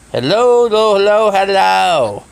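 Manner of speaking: calling out. Real, not acted. Calling into a silent phone call.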